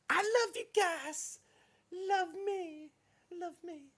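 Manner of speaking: very high, slow, mock-adoring squeak; joking falsetto. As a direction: squeak it out like a joke fan plea. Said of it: "No, I'm just kidding".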